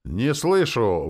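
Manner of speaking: loud, drawn-out high call; exclaiming. A narrator's reading. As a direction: goad the crowd to yell. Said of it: "воскликнула Ленора". (громкий протяжный возглас ведущей)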